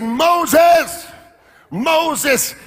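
"Moses" called out high and loud twice; a loud double call of "Moses". Retold or imitated. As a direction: call across the desert, "Moses, Moses". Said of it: "He called him".

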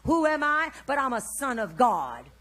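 loud, ringing proclamation; bold faith declaration. Shown as a demonstration. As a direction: preach it out with drawn-out opening words. Models a faith declaration aloud.

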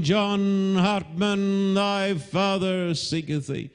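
very drawn-out, high cry; long, high, almost chanted call; searching call. Retold or imitated. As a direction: keep calling slowly across the field. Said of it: "the old Quaker farmer went on".

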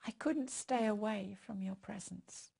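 hushed intimate prayer. Acted. Leads prayer.

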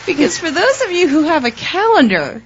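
high laughing confession. Real, not acted. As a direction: laugh while you admit it. Laughing at late taxes.

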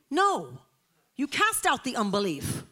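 loud, firm "No", then "cast" hit hard; firm correction. Real, not acted. Preacher correcting the congregation's prayer, firm and loud. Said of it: "preacher correcting".

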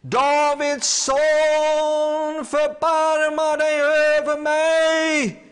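high, loud, very slow plea. Acted. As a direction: stretch every word, calling even harder past the crowd. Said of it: "han ropade bara ännu högre".

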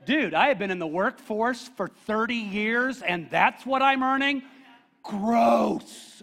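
a put-on complaining churchgoer; mock whiny worker. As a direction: sulk about the paycheck. Congregant griping about his pay.

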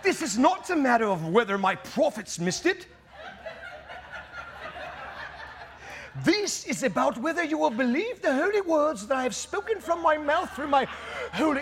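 put-on high, theatrical old-English God; King James voice. God chides the church over the prophets, hammed-up King James voice. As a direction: declaim it like a mock-Shakespearean deity. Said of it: "in his King James voice going".

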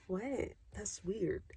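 hushed, puzzled reaction, imitated; taken aback. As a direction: drop to a soft, weirded-out murmur. Retells the producers' puzzled reaction.

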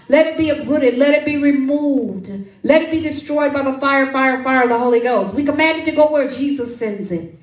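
loud, rising prayer intercession; fervent prayer. Acted. Preacher praying over the congregation, fervent and loud. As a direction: pray it out over the people with fire.

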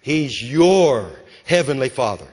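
"your" held long and strong; stress on YOUR. Shown as a demonstration. Preacher presses it on the congregation, stressing "your". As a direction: stretch and weight "your", then fall. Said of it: "He's not just the Father".

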